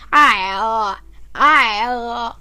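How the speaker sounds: short loud imitated robot roar; toy dino roar. Kid imitating her robot dinosaur's favourite roar. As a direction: bark out the toy's funny roar. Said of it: "That's the funniest roar".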